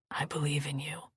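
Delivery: low gentle whisper; soft reassuring. Acted. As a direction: reassure her tenderly.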